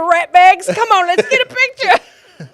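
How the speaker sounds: slow, high mock-Aussie holler; Australian accent. Australian dad calling his kids over for a picture, loud imitated Australian accent. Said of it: "He calls his kids rat bags".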